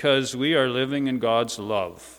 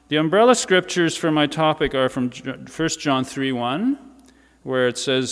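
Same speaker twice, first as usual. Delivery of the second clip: normal talking voice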